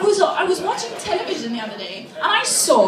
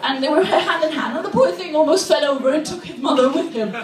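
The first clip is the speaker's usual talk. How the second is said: normal talking voice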